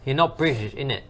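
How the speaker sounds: a hint of a British accent, badly done; weak British accent attempt. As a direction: try a British accent and fall on it. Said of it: "worst fucking attempt to say British".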